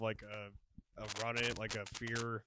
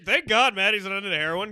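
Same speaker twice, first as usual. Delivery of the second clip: ordinary talk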